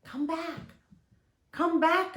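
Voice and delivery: put-on high-pitched child calling someone back; anxious little girl's cry. A narrator's reading. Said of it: "I cried".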